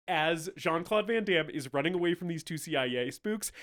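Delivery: normal talking voice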